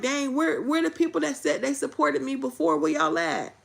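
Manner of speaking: frustrated, half-shouted where y'all at; hurt calling out. Retold or imitated. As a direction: call out for the people who said they had your back. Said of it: "And I'm like".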